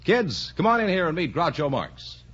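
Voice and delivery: ordinary talk, nothing marked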